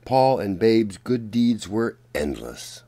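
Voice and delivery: plain reading voice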